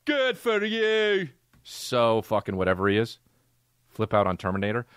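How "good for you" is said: high, slow mock-rant imitation. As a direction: draw it out high, mocking an angry actor. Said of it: "When he does that".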